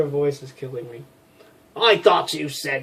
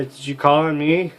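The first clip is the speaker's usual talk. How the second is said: ordinary talk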